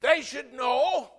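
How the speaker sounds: loud, slow, high declaration; emphatic shout. Real, not acted. Preacher booming at the congregation. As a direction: hammer home each word.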